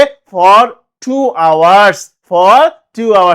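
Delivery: for two hours, said loud and drawn out; emphatic answer. Shown as a demonstration. Teacher answering his own question, loud and slow. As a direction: hammer the answer home. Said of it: "তাহলে কি বলতে হবে?".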